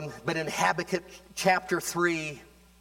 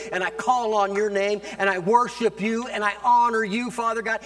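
rising fervent prayer; 3 semitones higher, 3 dB louder than usual. Acted. Preacher praying aloud, building from hush to full force. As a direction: pray it out, swelling to a cry.

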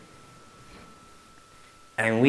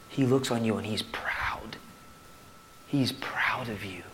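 softer, whispered reassurance; hushed, tender words of God's pride; 5 dB quieter than usual. Real, not acted. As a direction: whisper it like a proud father.

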